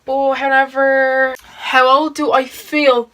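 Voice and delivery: ordinary talk, nothing marked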